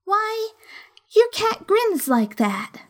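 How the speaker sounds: put-on high, slightly slow girlish voice; curious little girl asking; young girl voice. A narrator's reading. Alice's voice continues.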